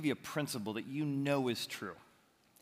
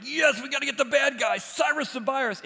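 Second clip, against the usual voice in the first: high, loud urging on the heroes; eager cheering; 11 semitones higher, 6 dB louder than usual. Pretend. Moviegoer urging the heroes on, high excited cheer. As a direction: cheer on the chase. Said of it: "you're like".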